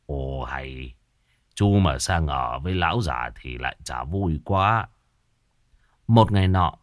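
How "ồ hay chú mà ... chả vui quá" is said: low, aged voice, amused; low elderly mumble of good humour; toothless chuckling old man. A narrator's reading. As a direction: answer like a toothless old man pleased by a joke. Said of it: "Ông cụ chỉ cười móm mém".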